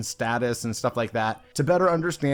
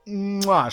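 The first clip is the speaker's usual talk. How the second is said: exaggerated "mwah" kiss sound; chef's kiss; 6 semitones higher than usual. Commentator savoring a hilarious mistake, exaggerated chef's kiss. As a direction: smack out a savoring mock kiss. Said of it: "chef's kiss hilarious".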